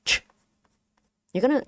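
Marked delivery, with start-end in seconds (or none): 0.0-0.2 "ch" with strong air pushing out; English ch. Shown as a demonstration. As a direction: push air hard on "ch". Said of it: "In English, when we say the".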